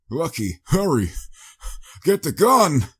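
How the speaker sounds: rising into a loud, high urgent call; crying out. Acted. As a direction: shout for help while pinned down. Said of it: "Wester cried out".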